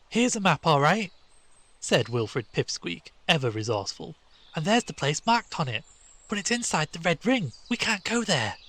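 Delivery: put-on a keen boy showing off his map; eager swot. A narrator's reading.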